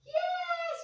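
very high, sing-song dog talk; high-pitched puppy voice. Real, not acted. High voice commanding and praising puppy.

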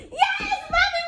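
high victory whoop; excited shout. Real, not acted. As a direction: shout out the win. Mom wins the toss game.

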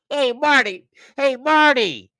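playful shout-out; calling out. Real, not acted. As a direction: greet a viewer across the room. Calling out to a chatter.

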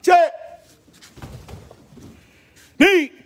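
sharp high count shouts; shouted count. Real, not acted. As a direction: bark out each count loud and high. Karate teacher shouting count.

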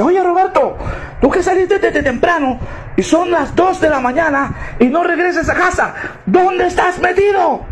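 a shrill demanding wife on the phone; wife yelling at her husband on the phone; furious wife. Acted.

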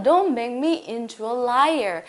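very slow, every word drawn out; slow demo. Shown as a demonstration. Teacher reading slowly to the class, drawn out. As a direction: read it slowly for learners to copy. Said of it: "자, 여기 볼게요".

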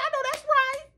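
high, squealing "I know that's right"; excited agreement. Retold or imitated. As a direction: cheer it on in a high voice. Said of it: "Okay, I said".

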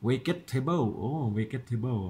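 vegetable as "we-get-te-bo", full Thai-style syllables; wrong reading. Shown as a demonstration. Teacher showing what it is not, wrong Thai-style reading. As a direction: misread vegetable with a w and a hard g. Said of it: "อันไม่ใช่นะ".

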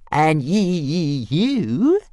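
high, slow stammering little dragon; calm taunt. A narrator's reading. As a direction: stutter it out, unbothered. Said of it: "said Toothless calmly".

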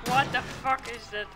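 usual speaking voice

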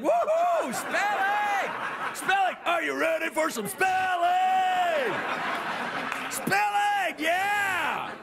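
high whooping cheer, stretched out; mock fan frenzy. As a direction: hype it up like a hammed-up arena crowd-rouser.

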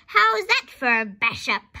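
loud boastful grandma shout. A narrator's reading. Grandma boasts from the roof to George, shouting. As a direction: shout it down with pride. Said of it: "she shouted".

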